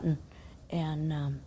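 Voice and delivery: ordinary talk, nothing marked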